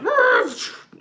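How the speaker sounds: high startled yell as you floor it; panicked yell. Retold or imitated. Driver peeling out in a panic, high yell. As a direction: let out a panicked cry. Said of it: "I was like".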